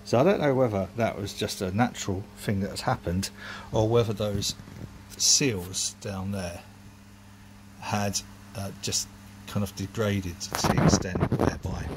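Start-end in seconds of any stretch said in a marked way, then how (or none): none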